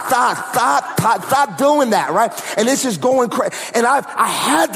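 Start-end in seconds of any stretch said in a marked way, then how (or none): 0.1-2.1 high, lisped "stop" with tongue held; biting the tongue. Retold or imitated. Ordering his tongue to stop, tongue-bitten and high. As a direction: imitate fighting your own runaway tongue, "stop" coming out as "thot". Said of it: "I'm trying to bite my tongue".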